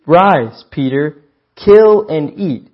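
loud, slow command, rising on kill and eat; commanding voice from heaven. Acted. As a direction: boom out the order like a divine voice. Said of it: "there came a voice to him".

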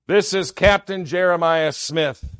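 ordinary talk, nothing marked

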